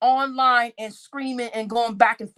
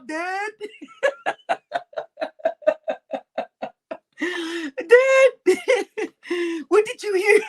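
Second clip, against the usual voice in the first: high, laughing, panicky plea to dad; giggling nervous plea; nervous laughing call; 9 semitones higher, slower than usual. Pretend. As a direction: laugh through a nervous question.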